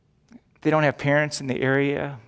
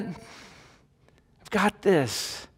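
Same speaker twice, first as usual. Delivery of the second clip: God's calm promise, stretched and softer; slow, soft assurance; 3 semitones higher, 6 dB quieter, much slower than usual. Shown as a demonstration. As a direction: let each word settle, very slowly and softly. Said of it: "Does it give you peace yet?".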